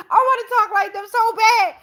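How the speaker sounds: high-pitched laughter through the words; can't stop laughing at herself. Real, not acted. Cracking up at her own British accent try.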